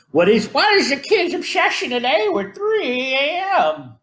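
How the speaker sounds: put-on high, thin old man voice. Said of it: "That was my old man voice".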